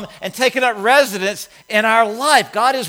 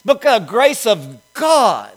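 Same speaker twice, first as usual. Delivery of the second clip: normal talking voice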